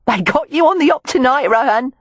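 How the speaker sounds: high Cockney jeer; Cockney exclamation. A narrator's reading. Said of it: "she exclaimed".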